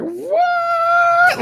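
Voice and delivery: very high, loud shrieked "What?"; shocked shriek. Retold or imitated. Retelling seeing her soaked partner, shrieking in shock. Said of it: "I'm like".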